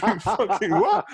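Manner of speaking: high, loud, slow incredulous yelp through a laugh; shocked disbelief. Retold or imitated. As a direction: blurt it out in mock horror, laughing. Retold disbelieving reaction.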